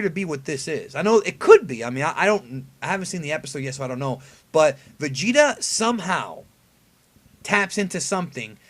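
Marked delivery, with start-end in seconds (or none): none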